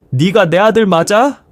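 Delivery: high, indignant scolding; angry challenge. A narrator's reading.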